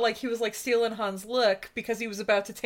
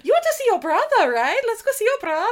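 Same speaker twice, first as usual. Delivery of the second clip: rich German host; 10 semitones higher, 6 dB louder than usual. Retold or imitated. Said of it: "Rich German guy comes down the steps".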